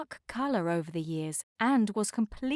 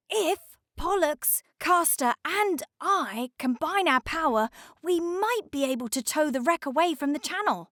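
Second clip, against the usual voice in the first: icebreaker character voice; 7 semitones higher than usual. A narrator's reading. Snow icebreaker voice.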